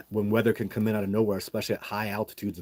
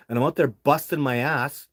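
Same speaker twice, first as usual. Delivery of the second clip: heated, raised venting; real exasperated venting about the agency; 4 semitones higher, 5 dB louder than usual. Real, not acted. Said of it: "I'm just venting a little bit".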